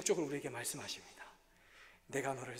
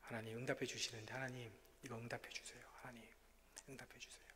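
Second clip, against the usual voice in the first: hushed, softer pleading to God; whispered prayer; 4 semitones lower, 7 dB quieter than usual. Retold or imitated. Pastor retelling his sick-night prayer, whispered. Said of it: "하나님께 계속 기도했어요".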